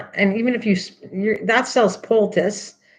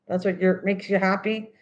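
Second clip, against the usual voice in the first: ordinary talk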